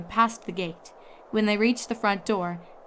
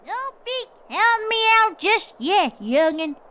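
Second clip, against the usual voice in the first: put-on feisty old Granny shouting over the wind, louder and much higher; defiant elder yelling into the storm; shouted; 13 semitones higher, 6 dB louder than usual. A narrator's reading. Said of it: "shouted Granny Smith over the roar".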